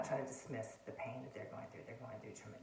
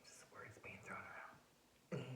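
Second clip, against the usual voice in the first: whisper; 3 semitones lower, 9 dB quieter, slower than usual. Real, not acted. Minimising verbal conflict, hushed.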